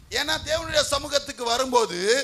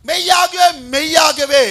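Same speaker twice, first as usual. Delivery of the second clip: very loud, ringing scripture cry; loud proclamation; 3 semitones higher, 13 dB louder, slower than usual. Real, not acted. Preacher repeating the reader's verse to the church, loud proclamation. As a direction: hurl the words out over the congregation.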